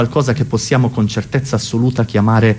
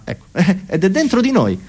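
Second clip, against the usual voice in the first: usual speaking voice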